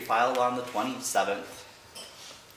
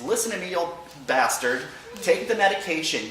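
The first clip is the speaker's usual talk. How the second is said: louder, higher affectionate scold; firm scolding; 6 semitones higher, 5 dB louder than usual. Retold or imitated. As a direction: order stubborn dad to take it. Scolding dad on phone.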